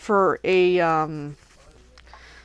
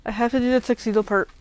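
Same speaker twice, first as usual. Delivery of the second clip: ordinary delivery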